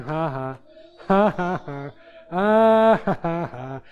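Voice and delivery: re-enacted chuckle. Dreamer laughing at the devil, re-enacted building laugh. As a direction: grow a deliberate laugh from small "ha-ha". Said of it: "it started off with me just going".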